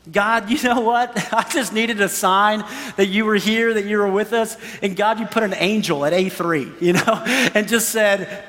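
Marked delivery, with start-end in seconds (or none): none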